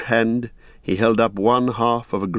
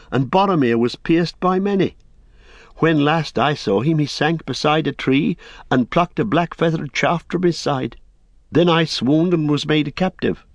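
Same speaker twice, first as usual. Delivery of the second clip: higher, earnest hobbit voice; young hobbit, proud; 5 semitones higher than usual. Acted. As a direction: defend a fallen friend with pride. Pippin honours Boromir.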